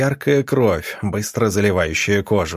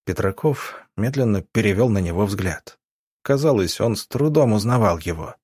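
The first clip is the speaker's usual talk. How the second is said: plain reading voice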